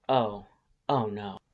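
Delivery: ordinary delivery, nothing marked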